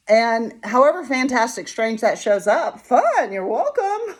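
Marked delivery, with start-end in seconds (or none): 2.8-4.2 high-pitched amused reply; laughing, playful. Real, not acted. Speaker to whoever shows up, laughing welcome. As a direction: toss it off and break into laughter.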